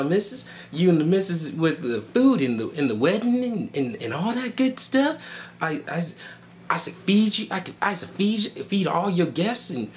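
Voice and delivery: high, servile, pleading put-on voice; hammed-up apologetic servant, drawing words out; caricatured servant. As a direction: grovel nervously in a high singsong. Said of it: "dress up like a slave and talk like this".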